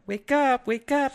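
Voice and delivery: high sing-song wake-up call; girlfriend voice. Retold or imitated. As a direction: coax him awake, high and sweet. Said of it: "laying on top of me going".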